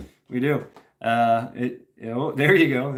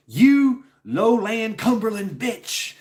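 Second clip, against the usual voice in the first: higher, slow Southern drawl, "You lowland Cumberland bitch" stretched out; Southern drawl insult; 9 semitones higher, 3 dB louder, much slower than usual. Retold or imitated. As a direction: drawl it out like a slapping Southern girl. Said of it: "she was Southern drawl, twang".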